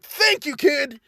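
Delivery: high cheery announcer voice; grateful DJ beaming at a student; President Mike voice. Retold or imitated. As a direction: pitch it way up like a hype radio host. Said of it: "President Mike's just like".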